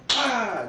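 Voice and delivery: loud high imitated yelp; a loud, high, hammed-up yelp; mock yell of pain. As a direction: yelp as if just hit. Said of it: "He'd be like".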